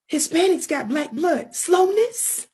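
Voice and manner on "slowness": ordinary talk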